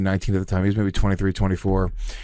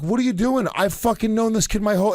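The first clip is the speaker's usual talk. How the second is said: higher-pitched retold indignation; indignant protest; 8 semitones higher than usual. Confronting a man shaking down his weed guy, indignant. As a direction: protest in rising disbelief. Confronting fake cop in story.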